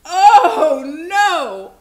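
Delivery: very loud, high storybook dismay; long, booming cry of alarm. A narrator's reading. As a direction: belt it out in mock horror.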